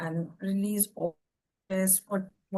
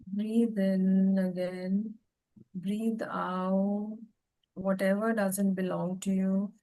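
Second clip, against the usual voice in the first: slow, calm breathing guidance; guided meditation; slower than usual. Acted. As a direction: lead them through slow breaths.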